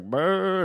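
high mock groan; short high noise of someone struck down; imitated dying noise. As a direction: imitate a cartoonish collapse. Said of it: "he's like".

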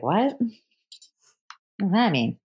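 lightly done Australian accent, mildly mock. Puzzled Australian guy answers the girl's tease, a light Australian accent. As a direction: ask it like a puzzled Aussie bloke. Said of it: "where's he from in that impression".

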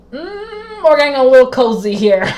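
hint that she's getting too comfortable; knowing tease. Retold or imitated. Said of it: "I was just like".

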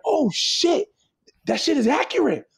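high-pitched mock astonishment; acted amazement. Visitor hearing real Dominicans in D R, acted amazement. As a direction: play a stunned visitor, voice leaping up high.

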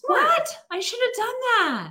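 high, surprised exclamation. Real, not acted. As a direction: react with real surprise. Surprised at microwave syrup trick.

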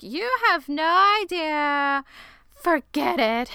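high, drawn-out mock exasperation; acted exasperation. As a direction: imitate her giving up, pitched well up. Retold heated exchange between characters.

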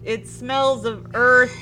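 ordinary delivery

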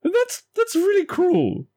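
high, rising, petulant whine; high whine building to "I want donuts"; whiny complaint. Acted. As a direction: sulk like a cheated child. James sulks at the trick.

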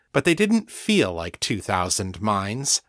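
plain reading voice

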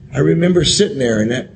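normal talking voice

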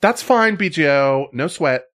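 put-on high, bright teen girl; girly voice.

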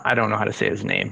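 ordinary talk

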